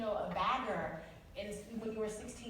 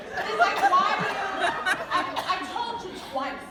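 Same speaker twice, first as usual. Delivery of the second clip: exasperated, laughing; 10 semitones higher, 9 dB louder than usual. Real, not acted. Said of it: "That's really how I get mad".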